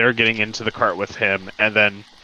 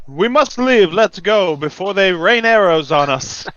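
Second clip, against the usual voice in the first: higher, louder, urgent order to move out; rally everyone to leave, pitched up and loud; urgent slender man; 9 semitones higher, 5 dB louder than usual. Acted. Slender man urging the camp to flee before arrows rain, urgent and loud. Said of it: "Snaps his finger and goes".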